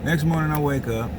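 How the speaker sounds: ordinary talk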